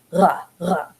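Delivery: "ra, ra" rolled at the back like French. Shown as a demonstration. Teacher showing modern Hebrew's French-style back r. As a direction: roll the r in the throat, French style. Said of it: "sort of like the French".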